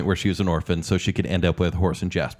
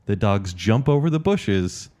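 ordinary talk

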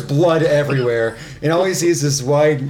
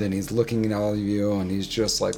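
ordinary delivery

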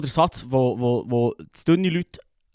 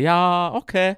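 higher-pitched, reluctant yes; reluctant agreement; 4 semitones higher, much slower than usual. Retold or imitated. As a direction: give in because refusing feels impossible. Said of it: "ich kann auch nicht Nein sagen".

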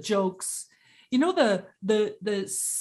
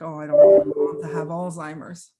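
worried inner voice; 4 semitones lower, 8 dB louder than usual. Retold or imitated. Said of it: "my biggest fear was like".

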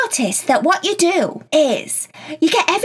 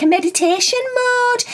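usual speaking voice

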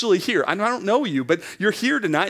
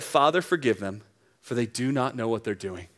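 soft, lower, fading prayer; tender plea; 7 semitones lower, 4 dB quieter than usual. Acted. Preacher voicing Jesus forgiving from the cross. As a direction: forgive them gently.

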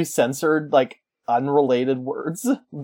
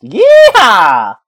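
slow, loud drawn-out yeehaw; cowboy yeehaw; 8 semitones higher, 12 dB louder than usual. Pretend. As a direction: whoop it like a cowboy. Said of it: "Kinda sound like an auctioneer with this voice".

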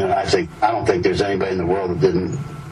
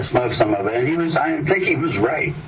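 ordinary delivery

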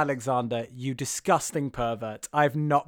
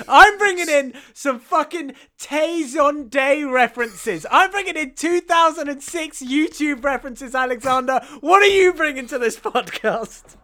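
mock boast; 11 semitones higher, 5 dB louder than usual. Teasing Alexander about podcast references, hammed-up mock boast. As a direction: puff up with fake pride.

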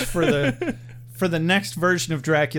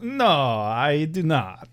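slow, drawn-out mock denial; slow hammed-up Dracula protest; 3 semitones lower, much slower than usual. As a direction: drag out a campy vampire no. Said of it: "He's like".

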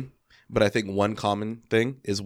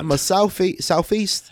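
mock London accent; 10 semitones higher, slower than usual. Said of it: "you saw how I rolled it".

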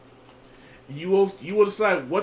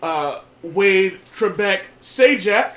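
loud game show host welcome; big showman welcome; ringmaster; 6 dB louder than usual. Acted. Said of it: "I am your host, Old Man Wade".

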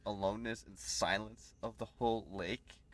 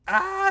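scream; 12 dB louder than usual. Retold or imitated. Acting out a scared person.

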